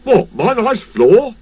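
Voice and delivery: mildly grumbling; grumbling guard. A narrator's reading. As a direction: protest about the nice floor. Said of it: "grumbled the guard".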